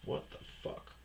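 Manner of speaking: soft, unvoiced disbelieving hiss; hushed baffled aside; whispered aside. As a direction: mutter it under your breath.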